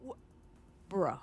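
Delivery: ordinary talk, nothing marked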